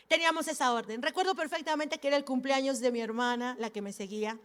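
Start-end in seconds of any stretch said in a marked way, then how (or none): none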